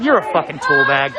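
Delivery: ordinary talk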